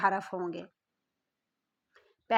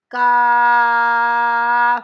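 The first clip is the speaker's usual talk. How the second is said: کاف called out loud and drawn out; long loud recitation of کاف; shouted letter; 8 dB louder, much slower than usual. Shown as a demonstration. Teacher reciting the letter aloud.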